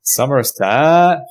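Somerset with long, drawn-out vowels; stretched West Country vowels, a Somerset burr; Somerset accent. Shown as a demonstration. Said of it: "give him your best Somerset accent".

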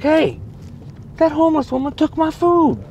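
high-pitched tattling protest; whiny, high retold grievance; indignant complaint. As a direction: call to the counter, aggrieved. Acting his complaint at the counter.

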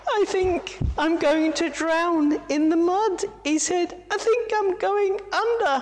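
very high, frightened little voice; high panicked monkey voice; drowning monkey. Acted. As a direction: cry out as the mud rises. Moto sinking panicked.

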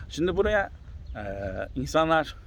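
normal talking voice

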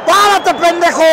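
high, loud yell across the crowd. Real, not acted. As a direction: shout it out to stop him. Yelling at someone in crowd.

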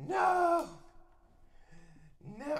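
dismayed no. Real, not acted. Bot command failed on stream.

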